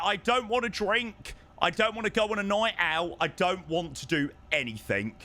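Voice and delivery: ordinary talk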